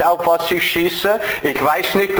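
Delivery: normal talking voice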